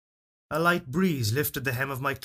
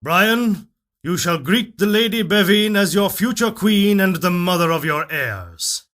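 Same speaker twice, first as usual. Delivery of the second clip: higher and much louder, a king's decree; commanding royal father; 4 semitones higher, 8 dB louder than usual. A narrator's reading. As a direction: announce it as the king, no room for argument. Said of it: "that distinctive voice of his that signaled his authority".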